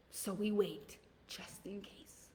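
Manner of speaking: slow, breathy whisper of just in case; whispered refrain. Pretend. Mocking fearful people, a slow whispered just in case. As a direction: hush it like a timid excuse.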